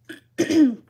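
loud throat clear. Real, not acted. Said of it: "apologize for being a little bit coughy".

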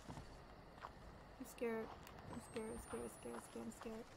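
hushed, nervous repeating under the breath; quiet, frightened self-talk; scared. Real, not acted. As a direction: mutter it over and over, very softly. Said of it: "Yo, I'm scared now".

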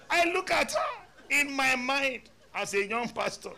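high, loud, laughing through the story; retold with a loud high laugh; laughing retelling. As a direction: laugh while retelling the plea. Pastor retells woman's plea, laughing.

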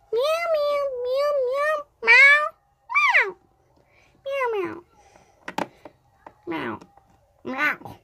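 high squeaky cat meows; kitten meows. Acted. Child pretending to be the cat, meowing high. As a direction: meow like a little kitten.

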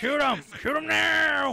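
loud, high shout at the enemies; yelling orders. Real, not acted. Gamer yelling at enemies to shoot him. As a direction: bark the order across the fight.

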